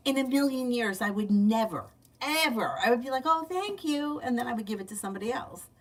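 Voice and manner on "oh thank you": high, sweetly polite thank-you; mock-polite thanks. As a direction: act out gracious acceptance. Acts out polite acceptance of candy.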